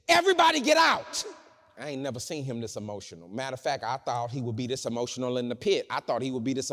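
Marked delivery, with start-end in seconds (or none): 0.1-1.2 loud, high-pitched shout to clear the room; shouted command. Retold or imitated. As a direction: shout it out over everyone's heads. Voices Joseph ordering the room cleared.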